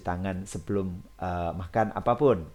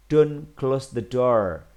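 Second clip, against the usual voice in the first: slow, firm, louder model sentence; plain command; 3 semitones higher, 6 dB louder, much slower than usual. Shown as a demonstration. Teacher models a don't command for pupils, slow and firm. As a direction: say the command slowly and with weight. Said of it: "Ketika kamu pengen lebih halus".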